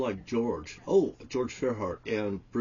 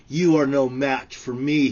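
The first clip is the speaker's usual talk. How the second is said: loud, raised, commanding declaration; booming quote; 4 semitones higher, 9 dB louder than usual. Retold or imitated. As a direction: proclaim it loudly, like God speaking. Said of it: "he says".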